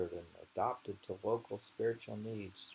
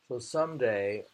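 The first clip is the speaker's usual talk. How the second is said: normal talking voice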